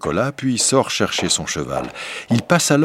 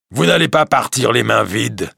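higher, louder monster voice; beast voice; 4 semitones higher, 3 dB louder than usual. Acted. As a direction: correct him with menace like a beast. Beast speaks to merchant. (la bête reprend le marchand, voix forte et aiguë)

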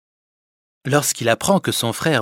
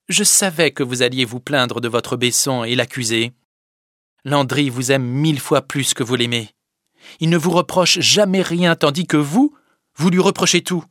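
higher, louder harsh reproach; scolding Fadette; 4 semitones higher, 7 dB louder than usual. Acted. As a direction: scold him without pity. Fadette accuse Sylvinet. (la fadette qui gronde, dure)

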